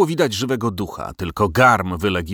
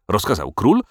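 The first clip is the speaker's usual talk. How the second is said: ordinary delivery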